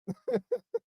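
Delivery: squeaky high burst of laughter; a sudden squeal of a laugh; real amused squeak. Real, not acted. Laughs at own near walk-out.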